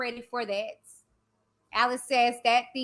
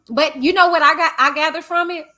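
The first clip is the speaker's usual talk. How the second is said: louder and faster, pressing the point; emphatic; 4 semitones higher, 7 dB louder, faster than usual. Real, not acted. Vlogger pressing her takeaway on viewers, emphatic. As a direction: drive it home to the chat.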